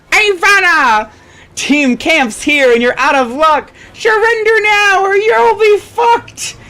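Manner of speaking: gloating villain. Acted. Kenya taunts Mia as the villain duo, high and gloating. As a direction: lay down the threat like a cartoon villain.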